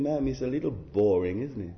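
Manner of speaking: lightly voiced bored child, low and soft; a hint of low-key boredom; bored children. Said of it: "Probably they're saying".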